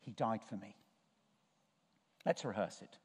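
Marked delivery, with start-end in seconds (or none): 0.0-0.8 soft murmur to yourself; a private, much softer murmur; under your breath. Shown as a demonstration. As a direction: say it barely aloud, inward. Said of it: "say to yourself under your breath".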